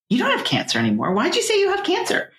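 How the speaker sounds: mock-offended questioning; indignant retold protest. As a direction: retell her challenging it, pitch jumping up. Daughter challenging mom after doctor leaves.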